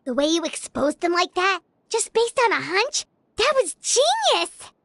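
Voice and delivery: high, gushing praise, stretching the last words; excited admiration. Acted. Impressed companion praises the exposure.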